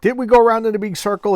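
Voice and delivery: loud, high, fast mock complaint; exasperated complaint. Assistant complaining to the lost hunter, loud mock exasperation. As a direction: blurt it out fed up.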